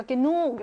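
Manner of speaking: "게누크" with a long second vowel. Shown as a demonstration. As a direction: stretch the 누 in "게누크". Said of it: "역시 길게".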